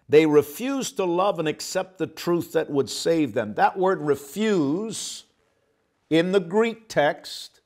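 ordinary talk, nothing marked

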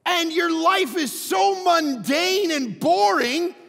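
real high, loud preaching yell; crying out. Real, not acted. Preacher urging a sleepy congregation to cry out, yelling. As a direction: cry it out with drawn-out key words. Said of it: "you never cry out".

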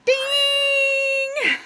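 high, loud laughing shout; excited. Real, not acted. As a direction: squeal it with a laugh. Joking surprise at temple clothes.

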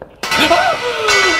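loud high startled gasp; sharp gasp. Real, not acted. Gamer hit by a jump scare, sharp gasp. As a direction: gasp as if something leapt out.

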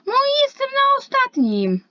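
squeaky whine. Acted. Squeaky complaint about last place.

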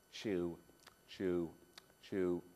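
low, slow chewing of a sheep. Acted. Boy showing the children what sheep do, low chewing. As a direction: chew it out like a dull sheep. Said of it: "Do you know what sheep do?".

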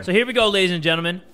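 ordinary delivery

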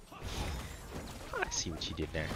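slow whispered repeat; slow knowing whisper. Shown as a demonstration. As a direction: breathe it out slowly.

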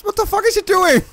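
very high, loud disbelieving squeal; shocked shriek. Real, not acted. Player reacts to what a game character is doing, shocked shriek.

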